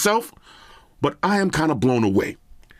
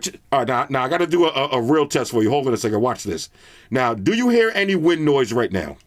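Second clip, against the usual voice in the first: usual speaking voice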